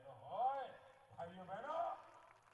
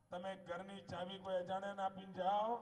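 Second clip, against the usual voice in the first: louder and higher line flung to the crowd; loud rally call; 3 semitones higher, 10 dB louder than usual. Real, not acted. Politician repeating his point loudly to the crowd. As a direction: throw the question out to the whole crowd. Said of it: "repeats the line to the crowd".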